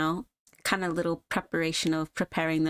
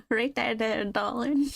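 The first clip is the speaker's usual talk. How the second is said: higher, coaxing southern darling; southern twang; 6 semitones higher than usual. Acted. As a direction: soothe him with a light southern twang. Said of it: "slightly giving a southern twang to her".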